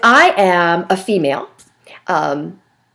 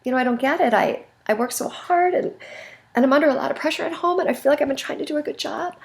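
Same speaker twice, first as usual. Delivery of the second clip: soft, choked-up pleading; teary-eyed plea; 6 semitones higher, 7 dB quieter, faster than usual. Shown as a demonstration. Fired man near tears pleading softly with the boss. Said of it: "as a male I got teary-eyed".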